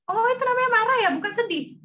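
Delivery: put-on high, loud, fast child asking; eager little kid checking; curious child voice. Child voiced in example dialogue. (suara anak kecil yang penasaran, tinggi dan cepat)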